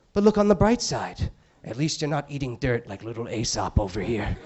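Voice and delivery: solemn TV appeal for donations; charity ad host. Retold or imitated. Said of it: "He's like".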